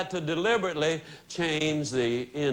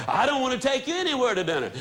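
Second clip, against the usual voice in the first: fast, high-pitched mock refusal; grumpy husband; 6 semitones higher, much faster than usual. Husband after a bad day refusing his wife, grumpy. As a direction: snap back quickly, pitched up. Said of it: "might have had a bad day himself".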